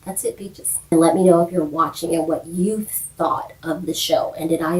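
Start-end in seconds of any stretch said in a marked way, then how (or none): 0.0-0.8 whisper. Real, not acted. Ending the video.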